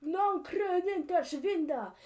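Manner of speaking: high, loud and drawn out; shouted war call. Acted. Scotsman behind rocks calls to his warriors, shouted war call. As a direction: bellow it as a warrior's command.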